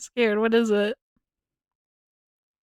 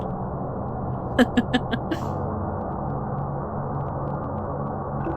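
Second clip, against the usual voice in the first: breathy silent laugh; 3 semitones higher, 4 dB quieter than usual. Real, not acted. Laughing after joke.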